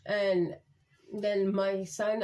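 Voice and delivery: ordinary talk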